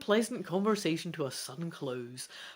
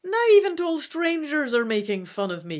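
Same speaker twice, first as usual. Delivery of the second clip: exclaiming; 9 semitones higher, 7 dB louder than usual. A narrator's reading. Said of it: "you exclaim".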